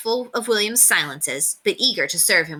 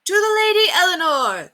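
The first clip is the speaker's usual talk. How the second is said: high, full roar of the crowd; crowd roaring back; 12 semitones higher, 4 dB louder than usual. A narrator's reading. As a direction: roar it back as a hall of voices. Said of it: "they roared back".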